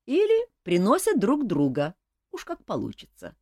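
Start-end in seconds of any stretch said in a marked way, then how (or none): none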